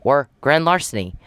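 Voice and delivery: ordinary delivery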